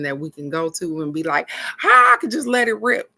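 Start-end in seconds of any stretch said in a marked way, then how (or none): 1.8-3.1 higher and louder, bursting out; mock venting rush; excited outburst. Imitating herself unloading on her best friend, higher and louder.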